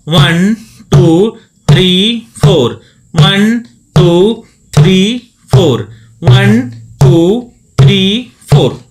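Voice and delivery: loud, steady count over the drum; counting aloud. Shown as a demonstration. Dholak teacher counting beats for students, loud. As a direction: call out each beat loudly. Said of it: "आपको ऐसा practice करना है".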